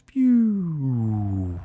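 vocal pew of a console switching off; power down noise. Pretend. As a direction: make a little electronic pew like powering down. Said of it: "The power down noises".

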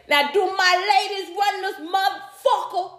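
high-pitched hype call to the crowd; comedian's crowd hype. Retold or imitated. Imitating a comedian firing up the women in the crowd, high hype call. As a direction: work the room like a stand-up hyping the women. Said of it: "she used to get up there and say".

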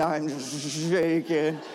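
drawn-out, shuddering word; acted-out trembling. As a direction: stretch it out as if trembling. Acts out shaking with fear.

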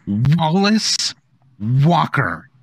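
firm scolding call of a name; stern full-name call. Acted. Angry Nana calls grandson.

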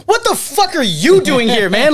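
high, drawn-out retold disbelief; astonished. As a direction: exclaim it slowly, acting out amazement. Retelling surprised reunion with friend.

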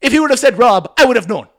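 high, loud accented mock rant; hammed-up outrage in a put-on accent; Hassan freaking out. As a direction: imitate an exasperated coworker losing it. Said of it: "He's freaking out".

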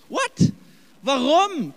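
high, loud disbelieving what; a stunned English what; baffled what. Acted. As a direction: jump up in sheer disbelief. Baffled by Thomas's reply.

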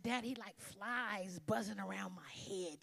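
slow whispered complaint; whispered exasperation. Retold or imitated. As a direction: whisper it worn out.

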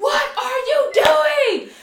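high, slow, drawn-out shout; retold yell. Boyfriend pulls her from police car, appalled yell. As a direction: imitate an appalled boyfriend, shouting each word. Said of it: "we're yelling at each other".

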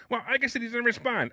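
high, complaining imitation of a pushy realtor; whiny realtor voice. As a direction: realtor yelling at the broker, high mocking voice. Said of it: "Can you do the rest of the show with that voice".

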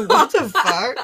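loud laughing what-the-fuck; shocked outburst. Retold or imitated. Sister recalling their shocked childhood reaction, laughing outburst. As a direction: blurt it out in laughing disbelief.

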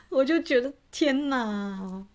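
real helpless laughter; laughing. Real, not acted. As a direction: laugh hard while speaking. Laughing at partner's joke.

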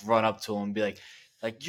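ordinary talk

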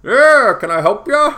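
high, loud put-on yokel doctor; hammed-up high country doctor; bored doctor. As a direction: play the offhand doctor shrugging you off. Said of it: "the doctor was just kinda like".

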